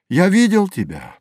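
high pitch, falling away at the end; rapturous recollection. A narrator's reading. Narrator addresses the dreamed kingdom, rapturous. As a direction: lift it high in wonder. Opening refrain of the recited poem.

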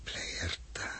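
very soft breathed name; awed whisper. Acted. Joost alone, murmuring the planet's name in a whisper. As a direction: barely breathe it out.

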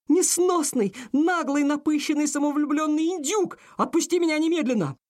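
high, fast-flung furious insults; furious heroine shriek. A narrator's reading. Aurora, trapped in the bath, shrieks at Randall furiously. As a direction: shriek the insults at him, fuming.

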